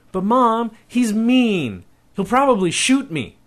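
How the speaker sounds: put-on a scared kid begging not to go; boy pleading with his mom, afraid; panicked boy. Boy protests in fear.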